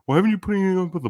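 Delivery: loud, high and fast nagging; mock yell. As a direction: imitate the boss yelling. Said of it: "get yelled at for not".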